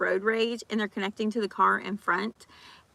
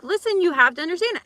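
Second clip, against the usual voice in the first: louder, higher stern instruction; retold firm lecture; 7 semitones higher, 7 dB louder than usual. [name] lecturing a gym-goer, loud and high. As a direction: lay down the law firmly. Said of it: "I'm like".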